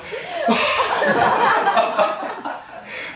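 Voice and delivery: real drawn-out giggle; long high laugh. Real, not acted. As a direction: crack up at your own joke. Laughing at own story.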